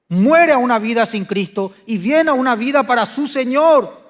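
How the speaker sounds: loud, high impassioned sermon, pushing every phrase; fiery preaching. Acted. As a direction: preach it at full force to the congregation. Preaching climax at full force.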